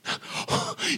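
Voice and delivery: sharp breathless gasp of horror; shocked gasp. Retold or imitated. Crowd gasps hearing the king was killed, shocked gasp. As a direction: gasp as if hearing the worst news. Shocked listener in retold scene.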